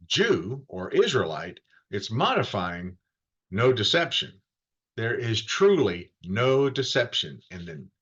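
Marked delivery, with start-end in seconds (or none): none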